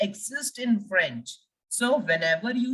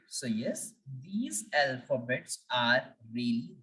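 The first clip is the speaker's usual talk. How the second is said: normal talking voice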